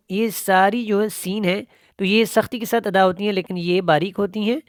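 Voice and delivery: ordinary talk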